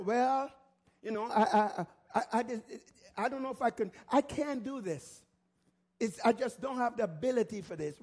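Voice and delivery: hesitant, stammering defeatist voice; self-doubting. Acted. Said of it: "like people say".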